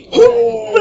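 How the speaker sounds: sharp loud high gasp; shocked gasp. Retold or imitated. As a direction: gasp in horror. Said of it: "And I was like".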